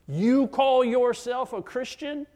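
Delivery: loud, high, slow incredulous accusation; accuser's challenge. Acted. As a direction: act the accuser casting doubt. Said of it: "the voice of the accuser".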